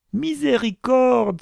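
very high, drawn-out wail; horrified cry. A narrator's reading. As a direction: cry out for mercy in alarm. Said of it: "s'écria Isaac". (cri d'effroi plaintif)

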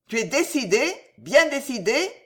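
hard, pressing interrogation. A narrator's reading. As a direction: demand confirmation harshly. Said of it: "Sa voix redevint dure".

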